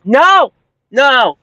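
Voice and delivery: loud, high shocked cry of no; shouted no. Real, not acted. Vlogger recalling the moment on the video, shouted no. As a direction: yell it as the worst happens.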